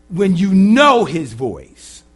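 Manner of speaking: loud, high, stretched-out "know"; stress on KNOW. Shown as a demonstration. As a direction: preach it big, drawing out KNOW. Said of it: "is not whether it's low or high".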